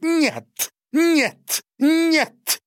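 high, loud wailing no; shrill crying out. A narrator's reading. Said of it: "графиня покричала". (пронзительный крик графини)

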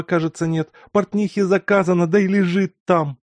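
high, loud, hurried fussing; a flustered mother fretting about dinner and dresses. A narrator's reading. Flustered mother frets aloud.

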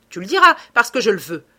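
loud, fast, forceful order; hard insistent dictate; imperious command. A narrator's reading. Lupin dictates orders by threat.

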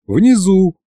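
plain reading voice

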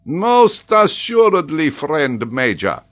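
high, loud, drawn-out agreement; theatrical scholar, slow and hearty; emphatic professor. Acted. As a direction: agree grandly, stretching the words. Paganel heartily agrees, amused.

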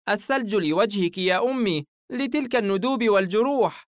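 put-on high little boy's voice; earnest child, pitched well up; affectionate young boy. A narrator's reading. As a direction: play a son caring for his mother. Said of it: "الفتى محتضنا والدته".

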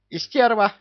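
high, loud parrot outburst; squawked insult. Acted. Parrot freed to answer Кевин, high angry squawk. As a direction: spit it out like an angry bird.

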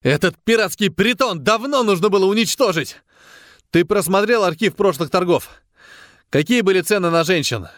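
high-pitched, heated tirade; angry outburst. A narrator's reading. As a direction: explode at your comrade in frustration. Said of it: "Крафт вновь взорвался".

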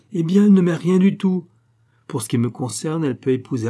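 loud, high, exasperated cry; angry outburst. A narrator's reading. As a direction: blurt it out furious. Harry heated at Lady Clavering.

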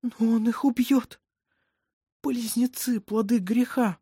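high-pitched, anguished voice; a frightened mother insisting on the danger; tearful dread. A narrator's reading. Mother fears husband will kill twins.

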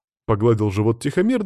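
plain reading voice

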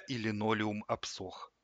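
usual speaking voice

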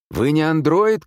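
loud, high-pitched call; shout. A narrator's reading. Man shouting to the wounded nurse, loud. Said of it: "крикнул он ей".

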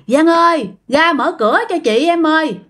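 high-pitched acted call at a door; mother's voice calling. Ghost posing as the mother outside the door, high calling. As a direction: coax someone to open up, pitched high. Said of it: "Mà đó là giọng của mẹ bạn".

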